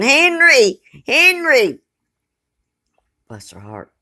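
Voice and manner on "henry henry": calling out high and loud; hollering. Retold or imitated. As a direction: shout a name through the door. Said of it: "he's hollering".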